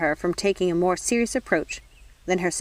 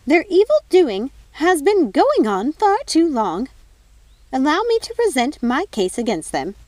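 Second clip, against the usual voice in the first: higher, louder, slow and outraged; indignant godmother; 9 semitones higher, 6 dB louder, slower than usual. Acted. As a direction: start hot, then settle into a formal tone. Godmother enraged, then formal.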